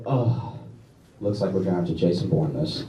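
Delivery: low, quiet tough-guy voice; a mock action hero sizing him up; gruff action hero. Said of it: "I was like".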